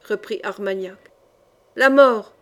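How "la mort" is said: loud, emphatic verdict from an angry crowd; foule furieuse. A narrator's reading. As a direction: call it out as a furious mob's answer. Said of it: "la voix énorme, faite de toutes ces voix furieuses". (voix forte d'une foule en colère)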